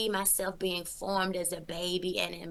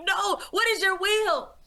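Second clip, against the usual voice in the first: frantic pleading; 11 semitones higher, 5 dB louder than usual. Retold or imitated. Pleading again with the Lord.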